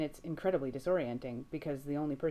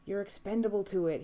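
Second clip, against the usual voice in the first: raised, desperate warning; urgent near-shout; 6 semitones higher, 4 dB louder than usual. A narrator's reading. Sam warning Dean in the dark, urgent near-shout. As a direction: push it out like a shout you can't quite let go. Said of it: "he wants to shout".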